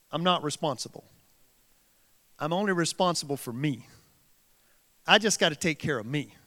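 a low, self-centred murmur; selfish voice, soft. Retold or imitated. Preacher mocking the selfish heart in a low voice.

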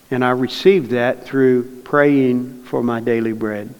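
normal talking voice

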